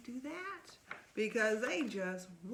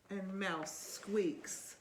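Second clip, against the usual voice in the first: put-on lively read-aloud to a child; storybook reading; 3 semitones lower, much slower than usual. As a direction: read it like bedtime story time. Reading picture book aloud.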